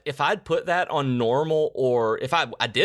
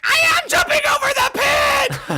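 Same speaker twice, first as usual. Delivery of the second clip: imitating wife's shrieked protest, very high; loud, high-pitched mock scream, every word hammered; 6 dB louder than usual. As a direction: scream it back in exasperation. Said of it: "because she was screaming".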